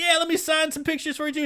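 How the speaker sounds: fast, high-pitched eager butting in; over-eager offer. Retold or imitated. Comedian horning in on autographs, fast high eagerness. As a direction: squeak it out quickly, keen to be included.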